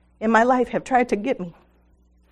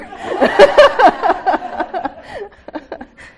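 high burst of real laughter; loud laugh; 10 semitones higher, 7 dB louder than usual. Real, not acted. Speaker laughing at her son's reply, loud and high.

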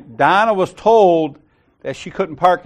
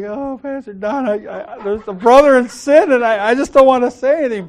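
high-pitched mock tearful reluctance; 9 semitones higher, 3 dB louder than usual. Churchgoer reluctantly reporting to her pastor, mock tearful. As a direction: imitate an upset churchgoer, high and hesitant. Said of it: "She was crying".

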